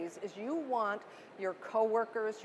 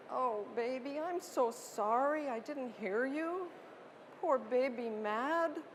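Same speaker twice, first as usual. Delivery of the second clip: soothing a newborn; 3 semitones higher, 4 dB quieter than usual. Shown as a demonstration. Mother soothing a hungry screaming newborn, soft cooing voice. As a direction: coo tenderly to a screaming infant. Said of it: "model for you what it sounds like to give empathy".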